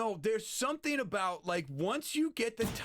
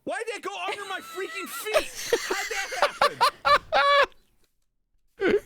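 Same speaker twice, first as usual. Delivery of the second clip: high indignant yell, louder toward end; hit hard crying out; 8 dB louder, slower than usual. Real, not acted. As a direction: yelp in outrage after getting hit. Hit in game, yelling in dismay.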